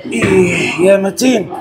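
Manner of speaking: slow, drawn-out rallying shout; effort shout. Real, not acted. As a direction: roar it out to gear up for the lift. Psyching up before heavy set. (صيحة حماس ممدودة قبل الرفعة)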